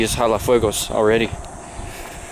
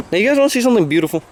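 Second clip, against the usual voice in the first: excited; 8 semitones higher, faster than usual. Real, not acted. Excited garden tour.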